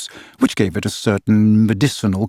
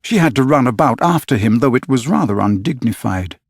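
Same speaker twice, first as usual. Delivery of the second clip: ordinary delivery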